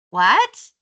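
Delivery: loud shouted question from the stairs. A narrator's reading. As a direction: call it down loudly, surprised. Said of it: "she shouted".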